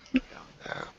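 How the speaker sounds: hushed whisper. Acted. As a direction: breathe it out secretly. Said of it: "Quietly shuffle".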